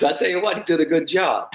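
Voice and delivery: high, put-on English South African voice; English South African accent. Instructor approving Arnold Palmer's grip lesson, imitated English South African accent. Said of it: "that great English South African accent of his".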